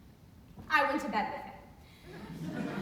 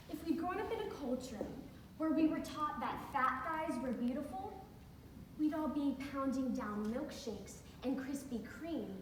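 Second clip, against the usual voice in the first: normal talking voice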